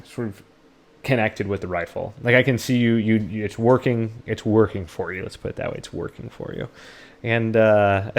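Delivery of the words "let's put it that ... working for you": soft breathy whisper, no voice; whispered aside. Real, not acted. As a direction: drop to a whisper for the repeat. Whispered repeat of own remark.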